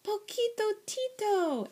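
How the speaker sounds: very high, delighted kitten cry; exclaiming. A narrator's reading. Kitten recognises his friend, exclaiming. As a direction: greet him with a high exclamation. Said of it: "exclaimed Skipito".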